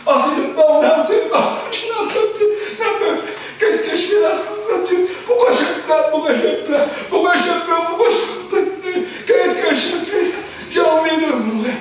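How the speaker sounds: high, loud laugh-crying despair; sob-laugh it near hysteria; hysterical breakdown. Acted. Burned-out worker breaking down, laughing and crying.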